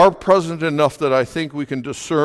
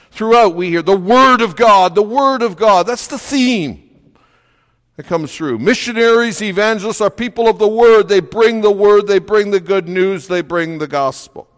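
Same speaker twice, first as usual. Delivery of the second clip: insistent preacher, hammering repeated phrases; emphatic preaching; 6 semitones higher, 5 dB louder than usual. Real, not acted. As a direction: drive it home from the pulpit, raised voice. Preaches with force.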